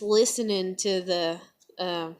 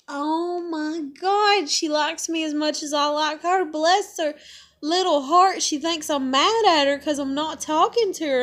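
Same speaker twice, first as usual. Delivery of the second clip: delighted; 7 semitones higher, 4 dB louder than usual. Retold or imitated. Retells her delighted inner thought.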